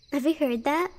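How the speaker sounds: normal talking voice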